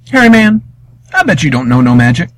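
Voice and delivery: cheeky bet, pitch up; young Wiley voice. Acted. Wiley daring the hairy man, cheeky.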